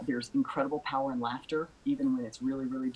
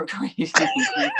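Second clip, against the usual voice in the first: laughing; 8 dB louder, much slower than usual. Real, not acted. Holding back laughter on air.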